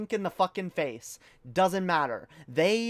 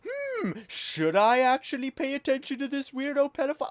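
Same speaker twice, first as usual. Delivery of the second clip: mock viewer; 9 semitones higher than usual. Mocking a viewer's thought.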